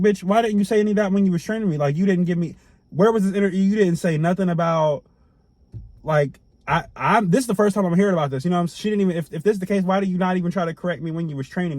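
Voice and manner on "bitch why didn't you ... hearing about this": higher and louder, fast furious comeback; angry retort. Retold or imitated. Ranting at the coworker who betrayed him, heated and fast. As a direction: tell her off like she lied to your face. Imagined rant at fake coworker.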